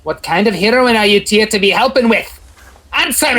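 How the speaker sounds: rising to a sharp, high shout; angry demand. Acted. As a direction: bark the order at them. Officer interrogates the heroes.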